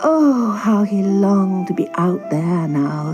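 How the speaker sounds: usual speaking voice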